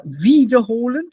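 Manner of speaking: wiederholen with WIE punched, separable; stress on first syllable. Shown as a demonstration. Teacher showing students separable wiederholen, stress on first syllable WIE. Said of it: "first syllable, separate".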